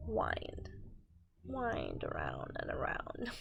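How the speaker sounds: whispered reread of the word; soft whispered repeat. Acted. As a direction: breathe the line out in a whisper.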